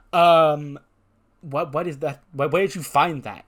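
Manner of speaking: acted panic at being exposed; flustered surprise. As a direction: stall loudly, then stumble into questions. Caught out by the evidence.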